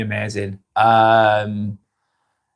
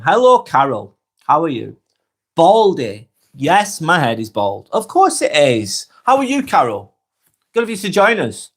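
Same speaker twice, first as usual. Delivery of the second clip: ordinary talk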